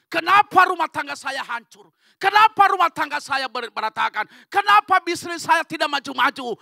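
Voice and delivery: loud, high preacher's cry, hammering each question; shouted lament. Retold or imitated. Believer asking why home and business fail, shouted lament. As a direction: belt out the desperate complaint. Voices anguished believers' complaints at full force.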